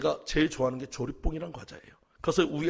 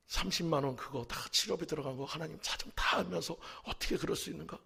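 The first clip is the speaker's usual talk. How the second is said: ordinary talk, nothing marked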